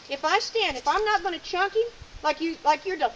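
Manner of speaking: ordinary delivery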